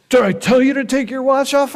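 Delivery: high, snapping mock yell; mock TSA yell. Guard scolding traveler for removing his watch, mock yell. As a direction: snap at him like an annoyed guard. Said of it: "the guy says".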